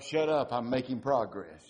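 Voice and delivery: loud, snappy retort; irritated holler upward; holler back. Retold or imitated. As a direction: bark it back up.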